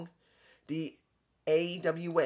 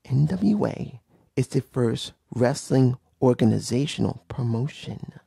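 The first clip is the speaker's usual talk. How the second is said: loud, lower-pitched, every word driven home; emphatic repetition; 5 semitones lower, 7 dB louder than usual. Real, not acted. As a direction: repeat it forcefully so it sinks in. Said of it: "Let me repeat that again".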